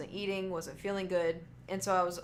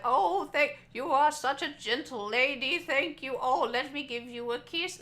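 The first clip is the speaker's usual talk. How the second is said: put-on higher, gushing old-man voice; happy old man; 6 semitones higher, 5 dB louder than usual. Old man thanking the waitress on his way out, gushing. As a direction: gush like a delighted old man leaving dinner.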